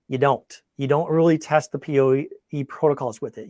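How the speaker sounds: ordinary talk, nothing marked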